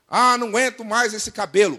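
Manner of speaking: loud, exasperated parody; mock complaining. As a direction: moan about it theatrically. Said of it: "tudo que você não reclamasse".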